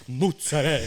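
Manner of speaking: "mozzarella" said as "mootsarell"; Italian-American pronunciation. Shown as a demonstration. Co-host showing how he says it, "mozzarella" as mootsarell. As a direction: drop the last vowel of "mozzarella": mootsarell. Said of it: "you make me sound like I'm [name]".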